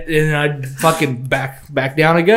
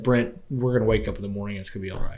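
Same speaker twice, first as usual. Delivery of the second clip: much softer, quick, half-whispered comfort; hushed reassurance; 5 semitones lower, 9 dB quieter, faster than usual. Retold or imitated. Curled in a sleeping bag, reassuring his friend, soft and hushed. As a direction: murmur it to calm your scared friend. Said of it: "that's how fucking terrified I was".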